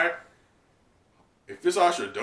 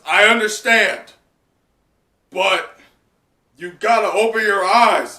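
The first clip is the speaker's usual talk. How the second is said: loud, higher forceful scolding; Ashura's stern shout; 7 semitones higher, 12 dB louder than usual. Acted. Ashura shouting Kinemon down, stern loud call. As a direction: yell at him to wake up. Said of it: "Ashura Doji interrupts him and says".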